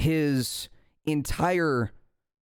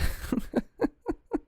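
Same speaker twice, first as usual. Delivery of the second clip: a tired, exasperated chuckle, not malicious; breathy, weary little laugh; 3 dB quieter than usual. A narrator's reading. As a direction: laugh like he's exhausted. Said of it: "like, a exasperated way".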